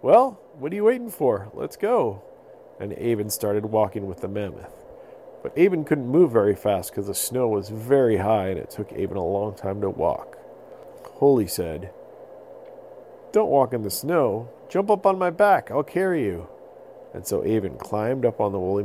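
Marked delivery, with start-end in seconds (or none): 0.0-2.2 high, louder eager voice; eager mammoth. Acted. Holy urging the boy to come along. 13.3-16.4 raised helpful mammoth voice; kindly mammoth. Acted. Holy offering the boy a ride on her back.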